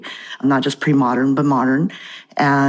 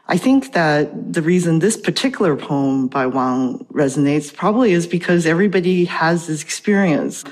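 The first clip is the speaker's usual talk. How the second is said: ordinary talk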